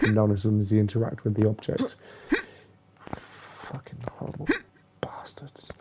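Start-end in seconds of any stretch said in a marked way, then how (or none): 3.2-5.7 soft whispered curse; muttered swearing at the game; cursing whisper. Real, not acted. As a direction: curse it under your breath. Cursing the game under breath.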